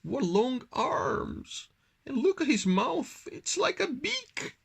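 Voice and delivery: high, drawn-out dismayed mother; horrified grumbling. A narrator's reading. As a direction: complain in rising high-pitched dismay. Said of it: "and she grumbled".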